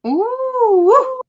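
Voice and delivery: long, high, swooping ooh; delighted rising and dipping "ooh"; admiring ooh. Real, not acted. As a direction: let out a drawn-out, high-pitched ooh. Admiring reaction to the hat.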